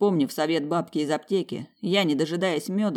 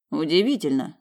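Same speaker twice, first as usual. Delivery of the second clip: ordinary delivery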